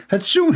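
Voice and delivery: high pitched mock sneeze achoo; fake sneeze. Comedian acting out a sneeze for the audience, high mock sneeze. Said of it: "You sneeze".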